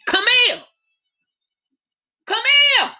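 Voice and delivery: high ringing shout across the yard; mother hollering her daughter's name; calling out. Acted. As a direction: call the girl in from outside, loud. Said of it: "calling for Camille".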